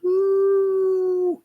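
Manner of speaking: a sudden high, falsetto whoop; whoop of delight. Retold or imitated. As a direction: let out a squeaky cheer as the ball flies. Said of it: "he goes".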